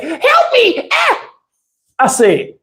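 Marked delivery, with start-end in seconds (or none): 0.0-0.9 very high, fake-crying "Help me!"; high mock wail. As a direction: wail it like a baby. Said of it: "He said".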